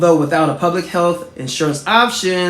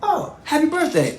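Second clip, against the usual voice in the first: lightly voiced cheerful greeting, higher; President greeting; 12 semitones higher than usual. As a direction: beam a quick happy birthday back. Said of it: "He said".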